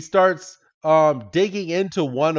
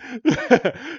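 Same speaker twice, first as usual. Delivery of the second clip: a sudden high squeaky giggle; 9 semitones higher than usual. Real, not acted. Cracking up at her own Pokemon retelling, high giggle. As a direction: break into a high little laugh.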